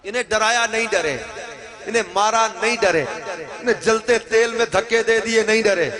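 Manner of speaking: loud, high, building sermon cadence; fiery preaching. Acted. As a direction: hammer each line home. Preaching at full force. (پرجوش خطابت)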